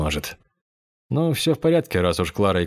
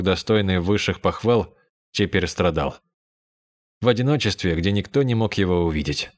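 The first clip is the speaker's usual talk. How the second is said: plain reading voice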